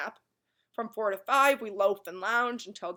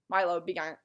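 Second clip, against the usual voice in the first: ordinary delivery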